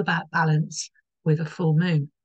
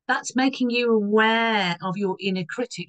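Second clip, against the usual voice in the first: ordinary delivery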